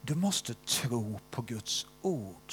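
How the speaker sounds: "du måste tro på Guds ord" drawn out, slow and deliberate; slow, weighty quote. Retold or imitated. Preacher quoting the teacher's first lesson to the seminar, slow and weighty. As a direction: give each word weight, like a teacher's key point. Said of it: "Då sa han så här".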